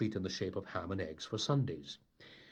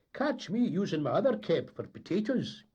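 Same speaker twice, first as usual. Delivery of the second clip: high, loud, indignant Scots deckhand; gruff Scots sailor; 11 semitones higher, 5 dB louder than usual. Acted. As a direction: bluster it like a Highland tar. The tar's voice.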